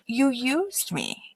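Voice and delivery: hurt-sounding retold charge; retold accusation. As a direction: draw it out quietly, accusingly.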